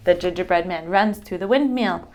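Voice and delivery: plain reading voice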